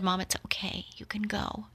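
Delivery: words barely get out, breaking into a whisper; choked whisper. Retold or imitated. Daughter at dying mother's bedside, whispering through tears. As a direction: let the voice fail to a soft whisper. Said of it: "I couldn't get the words, You can go, out".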